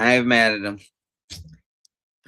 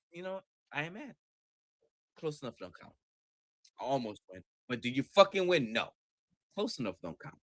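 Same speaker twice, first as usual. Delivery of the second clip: bitter grumble; 8 dB quieter, much faster than usual. Real, not acted. Bitter after a near miss.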